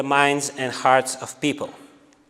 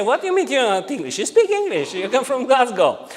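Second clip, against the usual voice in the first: high, fast, incredulous with laughter; laughing disbelief; 9 semitones higher than usual. Retold or imitated. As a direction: tease him, chuckling at the idea. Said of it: "And we say".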